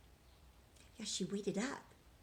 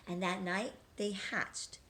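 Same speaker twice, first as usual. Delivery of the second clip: usual speaking voice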